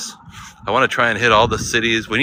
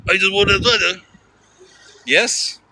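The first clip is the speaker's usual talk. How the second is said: put-on higher, garbled British accent; mock British cabbie accent; 9 semitones higher, slower than usual. Cab driver chatting to lone American passenger, garbled British accent. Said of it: "I don't know what he said".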